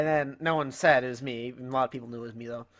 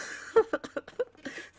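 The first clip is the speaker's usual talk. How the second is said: high, airy giggle; laugh; 13 semitones higher than usual. Real, not acted. Vlogger recalling dad asking about tattoo, laughing. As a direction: dissolve into breathless laughter. Laughs after retold groan.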